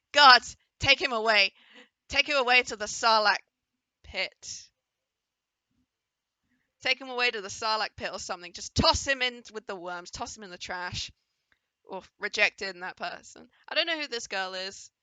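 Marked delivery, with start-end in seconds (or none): 0.1-11.1 mock shout. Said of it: "they're like".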